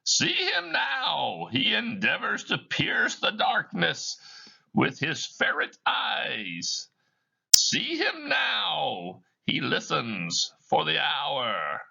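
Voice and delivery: a ghost talking to the audience; high, slow ghostly narrator; Marley's ghost. Acted. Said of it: "He moves to the staircase below Scrooge".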